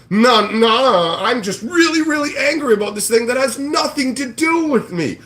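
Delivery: high, loud mock whine of the flipper; mocking whiny flipper. Streamer mocking the flipper's excuse, high whiny mock voice.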